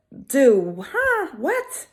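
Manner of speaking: a high, startled huh and what, pitched well up; mock disbelief. Arabella told she can't be a lawyer, mock shock. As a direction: play it stunned and baffled, lightly. Arabella hears she's refused.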